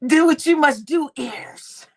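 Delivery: usual speaking voice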